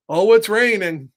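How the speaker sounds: usual speaking voice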